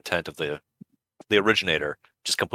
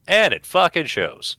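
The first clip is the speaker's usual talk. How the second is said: slow, higher, hit every word; emphatic punchline; 9 semitones higher, slower than usual. Real, not acted. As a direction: land it slowly and hard.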